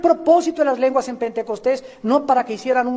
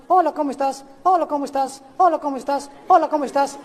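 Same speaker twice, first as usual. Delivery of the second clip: imitating a child; 4 semitones higher, faster than usual. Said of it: "imagínate un niño de cinco años".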